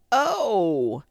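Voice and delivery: high, loud, long falling cry; pained wail. A narrator's reading. Pooh being pulled by all the friends, loud wail.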